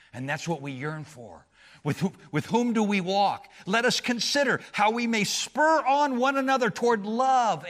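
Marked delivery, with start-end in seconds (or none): 1.8-7.7 loud, high, driving sermon voice; fiery pulpit proclamation; preaching at full force. Acted. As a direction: hammer the point home to the congregation.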